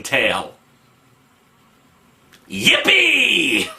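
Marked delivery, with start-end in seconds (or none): 2.5-3.7 high, drawn-out sarcastic yippee; mock cheer. Reviewer faces another exciting tale, high mock cheer. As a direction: squeal it in put-on delight.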